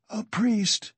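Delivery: high-pitched husky plea of a dying man. A narrator's reading. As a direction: articulate it huskily, pitched well up. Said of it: "he articulated huskily".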